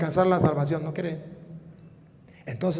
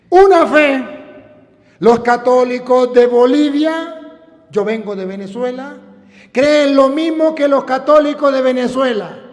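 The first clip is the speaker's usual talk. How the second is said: raised, ringing preacher's voice; fervent preaching; 7 semitones higher, 8 dB louder than usual. Acted. Preacher listing what Catholics believe, fervent preaching. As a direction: hammer out each article of faith.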